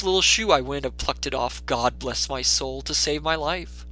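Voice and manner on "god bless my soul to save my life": usual speaking voice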